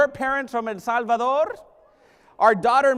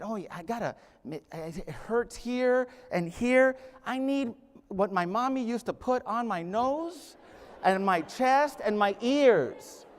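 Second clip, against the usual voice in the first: much softer, slow, feeble sick voice; sick whiny self; 2 semitones lower, 7 dB quieter than usual. Acted. Sick student telling his roommate what hurts, feeble and slow. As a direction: whimper like a sick kid wanting mommy.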